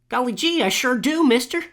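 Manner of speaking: put-on high, eager gee-whiz kid voice; wholesome 1950s kid. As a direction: play an old-timey wholesome boy. Eager kid answer in retold chat.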